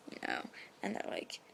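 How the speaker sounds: ordinary talk, nothing marked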